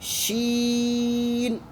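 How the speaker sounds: शीन drawn out with a hissing sh; whistled sheen. Shown as a demonstration. Teacher showing pupils a whistling शीन. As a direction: let शीन whistle through the teeth. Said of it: "सीटी के word निकालना है".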